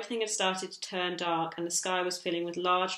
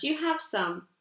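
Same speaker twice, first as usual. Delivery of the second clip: delighted exclamation, pitched higher; cried out; 7 semitones higher, slower than usual. Acted. Mum sees the pomegranates, higher delighted cry. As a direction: burst out in happy surprise. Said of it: "cried out Mum".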